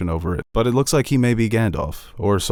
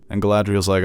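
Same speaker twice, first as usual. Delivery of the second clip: ordinary talk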